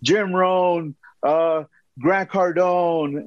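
eager, high, slowing down over the names; eager rattling off. Retold or imitated. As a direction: proudly list the gurus, reaching up high. Naive eager answer to boss.

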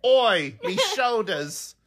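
put-on high-pitched cockney-style British accent, slow; hammed-up British accent. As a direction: shout out a mock cockney British complaint. Hammed-up British trainer bit.

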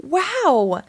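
high-pitched wow, mildly yelled; amazed yell. A narrator's reading. Max yells on seeing huge balloons, high and amazed. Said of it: "yelled Max".

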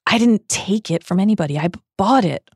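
acted upset, stammering denial; Missus Cattermole sobbing. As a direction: protest as if through sobs, tripping on words. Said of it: "sobbed Missus Cattermole".

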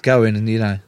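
ordinary delivery

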